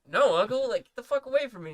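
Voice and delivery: retold protest. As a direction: push him off loudly, replaying it. Retold refusal to drunk uncle.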